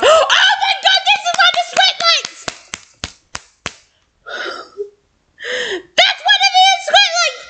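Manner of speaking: very high gasping squeal; excited squeal. Real, not acted. Reader realising what it is mid-story, squealing.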